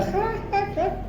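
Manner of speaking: high, breathy little giggle; imitated child giggle. A father mimics his son giggling at the verse.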